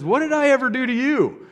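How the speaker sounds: high-pitched wounded protest; indignant donkey. Acted. Talking donkey turns around on the man beating it, indignant. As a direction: cry it out high, aggrieved at being beaten.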